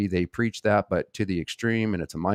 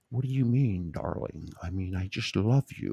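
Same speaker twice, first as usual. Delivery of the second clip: a poorly done, softer mock of her odd accent; sweet-talking mimicry of Karen D, badly faked; terrible Karen D impersonation; 6 dB quieter than usual. Youtuber mocking how Karen D talks, a weak impersonation. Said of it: "That was a terrible impersonation".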